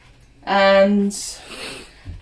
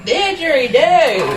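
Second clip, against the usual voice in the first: mock Australian echo; 5 semitones higher, 4 dB louder, slower than usual. Echoing husband's joke accent.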